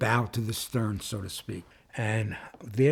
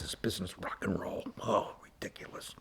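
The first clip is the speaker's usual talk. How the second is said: whispered scoff; 4 semitones lower, 12 dB quieter than usual. Retold or imitated. Father whispers his contempt for rock and roll to the passenger. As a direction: hiss it out as a disgusted aside.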